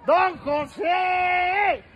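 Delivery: slow stretched call; calling don José to come out; drawn-out calling. Real, not acted. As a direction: stretch the name out. Long calls to the neighbor.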